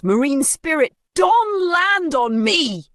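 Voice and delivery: very high, slow panicked scream; high drawn-out frightened yell; terrified yell. Acted. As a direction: scream as if he saw a ghost. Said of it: "he yelled".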